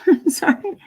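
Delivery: breathy giggling whisper of sorry; laughing whisper. Real, not acted. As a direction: whisper it through a laugh to the dog. Apologising to startled dog.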